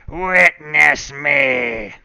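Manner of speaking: slow, drawn-out shouted creepy chant; creepy acolyte shout. Acted. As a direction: howl it like a cultist's call. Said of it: "One more time".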